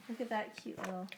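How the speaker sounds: usual speaking voice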